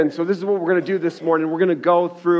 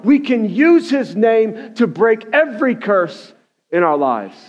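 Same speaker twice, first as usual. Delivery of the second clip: raised, high-pitched sermon delivery; impassioned preaching; 5 semitones higher than usual. Real, not acted. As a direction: drive the message home to the church. Preaches at full force.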